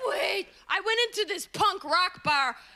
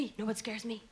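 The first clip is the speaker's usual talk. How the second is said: dropped low and much softer; spooky hushed retake of the same question; hushed repeat; 10 semitones lower, 11 dB quieter, faster than usual. Shown as a demonstration. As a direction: lean in and say it again under the breath.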